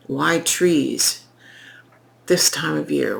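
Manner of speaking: ordinary talk, nothing marked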